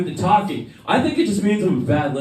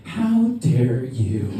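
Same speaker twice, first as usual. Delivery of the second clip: slow, drawn-out mock outrage; theatrical, half-laughing indignation; mock offence; 4 semitones lower, much slower than usual. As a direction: act deeply affronted, stretching every word. Acted mock offence at girl.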